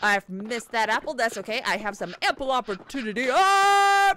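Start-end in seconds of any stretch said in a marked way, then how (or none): 3.3-4.1 startled cry. Real, not acted. Sudden startled cry in game.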